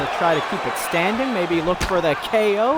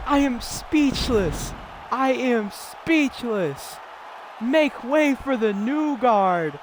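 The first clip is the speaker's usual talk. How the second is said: stunned; 7 semitones higher, slower than usual. Real, not acted. Stunned after the knockout.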